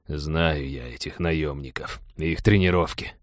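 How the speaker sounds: low, soft and slow, uneasily; hushed, wary pilot; uneasy shudder. A narrator's reading. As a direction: draw it out quietly, as if shuddering at the thought. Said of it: "поёжился пилот".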